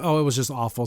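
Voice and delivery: ordinary talk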